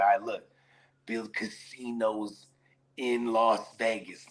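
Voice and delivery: low, very slow, quiet sales pitch. Retold or imitated. Pitching casinos to the commission, low and slow. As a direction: lean in and sell it slowly. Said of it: "You're like".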